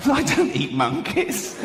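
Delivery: high, slow, laughing denial; high indignant chimp. Acted. Chimp denying to the man, high and giggling.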